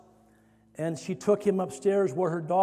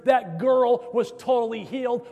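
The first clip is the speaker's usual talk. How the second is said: raised, driving preacher's crescendo; fervent testimony; 4 semitones higher, 4 dB louder than usual. Retold or imitated. Violinist telling students of the healing, fervent and building. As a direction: build urgently, pitch held high, punching the phrases.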